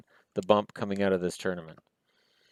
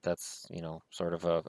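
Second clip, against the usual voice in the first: ordinary talk, nothing marked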